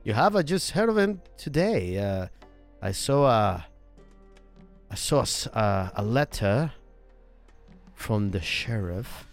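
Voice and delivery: put-on slow, halting Norwegian accent. Newcomer reporting the sheriff's letter, halting Norwegian accent. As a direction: feel for each phrase in Norwegian-accented English. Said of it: "a little bit of the Norway accent".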